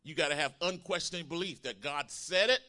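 preaching at full force. Acted. Preacher shouting to the congregation about faith. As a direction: preach it with rising fire.

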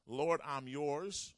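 slow, loud, surrendering prayer; a preacher voicing a vow of surrender; fervent prayer. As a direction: pray it out slowly with full weight. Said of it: "That means".

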